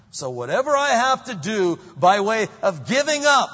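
loud, high full-force preaching. Acted. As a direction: preach it out with fire.

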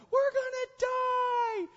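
hammed-up schoolgirl panic; panicked shriek. Preacher mocking the disciples in the storm, screaming in fake panic. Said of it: "hairy dudes are in a boat going".

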